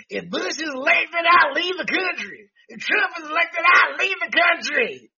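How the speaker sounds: high shrieking mock outrage; hysterical wail. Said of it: "what we get out of Democrats".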